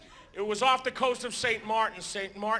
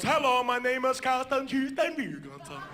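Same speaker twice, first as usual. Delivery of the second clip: put-on Norwegian accent; 3 semitones higher than usual. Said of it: "a Norwegian gentleman".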